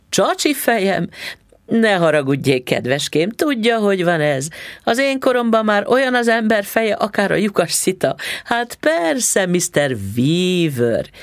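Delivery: a hint of a doting old woman; sweet old landlady. A narrator's reading. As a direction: coo like a kindly old landlady. Landlady apologizing, voiced.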